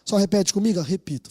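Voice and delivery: ordinary delivery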